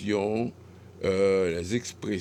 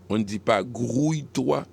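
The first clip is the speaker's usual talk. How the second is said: usual speaking voice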